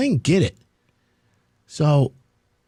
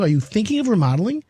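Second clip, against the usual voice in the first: normal talking voice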